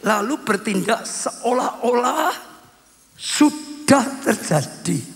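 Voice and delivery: very slow, drawn-out key phrase; slow teaching emphasis. Shown as a demonstration. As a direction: stretch it out for the congregation. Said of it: "Saya ulang ya". (pengajaran perlahan, kata demi kata)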